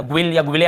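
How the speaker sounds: ग्विल, ग्विले with a W after G; wrong pronunciation. Shown as a demonstration. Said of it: "ऐसे नहीं पढ़ रहे हैं".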